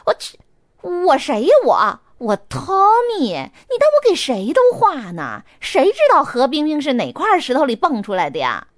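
发火. Acted. Tommy继续发火.

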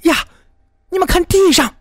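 very high-pitched alarmed call; startled cry. A narrator's reading. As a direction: cry out in shock to the onlookers. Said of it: "有人惊叫起来".

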